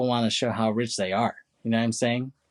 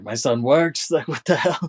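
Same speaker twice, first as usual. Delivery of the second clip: louder, higher imitation of an outraged father; mock pissed off; 6 semitones higher, 6 dB louder than usual. Father hearing his son works there, mock outrage with laughter. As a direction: play up the disbelief, breaking into a laugh. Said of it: "He's all pissed off".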